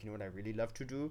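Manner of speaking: quick, soft, badly faked German accent; attempted German accent. German host confiding during castle tour, lightly attempted German accent. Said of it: "I was trying to do a German".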